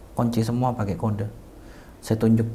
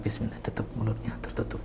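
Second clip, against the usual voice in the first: hushed, voiceless murmur; whispered prayer; 10 dB quieter than usual. Retold or imitated. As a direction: whisper it gently as a retold prayer. Membisikkan doa saat menutup mulut jenazah. (bisikan doa yang lirih)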